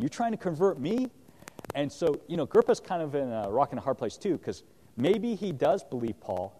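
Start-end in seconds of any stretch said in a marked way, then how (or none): 0.0-1.1 pitched higher in surprised disbelief; a king caught off guard, half amused; incredulous question. Retold or imitated. Agrippa answering Paul's appeal, higher startled disbelief. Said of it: "He's like".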